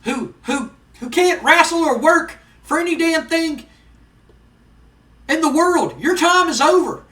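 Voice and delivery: high, loud wrestling-manager tirade; Jim Cornette promo. Acted. Manager disowns Vader and sets Ken on him, loud angry promo. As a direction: harangue him over the mic, high and hard. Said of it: "he gets into the ring and he goes".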